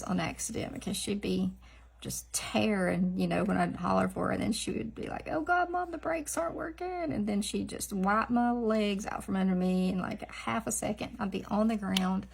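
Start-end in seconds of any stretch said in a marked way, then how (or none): 5.3-7.1 high panicky dog voice; mock panic as the runaway dog; voicing the dog. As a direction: play the dog who can't stop, pitched high.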